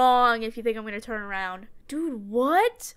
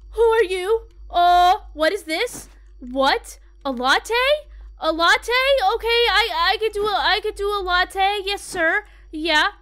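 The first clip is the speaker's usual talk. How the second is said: loud, raised, stammering nervous voice; flustered fright; 6 semitones higher, 7 dB louder than usual. Real, not acted. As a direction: stumble eagerly to obey a frightening customer. Frightened, serving a scary customer.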